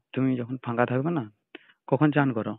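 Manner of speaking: usual speaking voice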